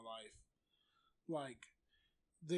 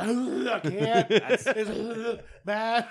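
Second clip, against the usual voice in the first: very slow, loud, laughing gagging words; imitating a gagging partner; 5 semitones higher, 13 dB louder, much slower than usual. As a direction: choke the words out between retches. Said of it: "He's like".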